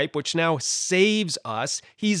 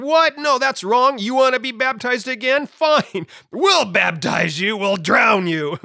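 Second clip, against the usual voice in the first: high, raised, indignant retort; mock outrage; 7 semitones higher, 5 dB louder than usual. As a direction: play an outraged opponent, hammed up. Acts out outraged group of Christians.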